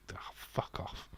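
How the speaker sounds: whispered embarrassed aside; self-mocking whisper. Real, not acted. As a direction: breathe it out unvoiced. Whispered after own impression.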